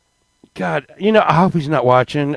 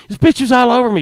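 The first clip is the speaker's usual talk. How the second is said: normal talking voice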